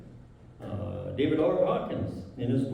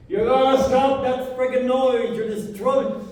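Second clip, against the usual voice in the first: loud, high, furious demand to stop; angry yelling owner; 10 semitones higher, 7 dB louder than usual. Retold or imitated. Mall owner storming down to stop the construction noise, yelling angrily. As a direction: bark it like an irate mall owner.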